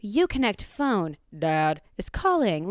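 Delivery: slow, imitation car phone system voice; dramatic car announcement. Mimicking the car announcing Dad's call, slow and dramatic. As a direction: announce it slowly, like an overdramatic machine. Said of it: "like, really dramatic".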